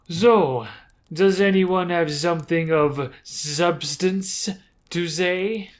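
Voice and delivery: put-on slow, deliberate French-accented matriarch; icy drow leader, drawn-out French accent; cruel French accent. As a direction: demand it slowly in a French accent. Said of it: "I gave the drow a cruel French accent".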